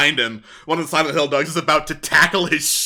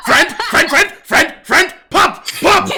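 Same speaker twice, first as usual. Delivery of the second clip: high, loud yapped single words; short high-pitched talking-dog yips; barking dog; 7 dB louder than usual. Acted. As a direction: bark each word out like an excited dog. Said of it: "a bunch of other barks echoing".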